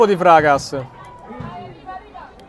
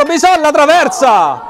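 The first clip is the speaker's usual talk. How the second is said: excited; 6 semitones higher, 3 dB louder than usual. Real, not acted. Shot hits the crossbar.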